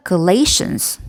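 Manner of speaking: Galatians with LA stretched as "lay"; stress on second syllable. Shown as a demonstration. Teacher showing where the stress falls, Galatians stressed on LA. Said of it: "레의 강세가 있습니다".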